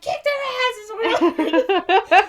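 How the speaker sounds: high, drawn-out mock battle yell; Rusty. Girlfriend orders Willard to fight back, hammed-up shout. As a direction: holler the order, breaking into laughter.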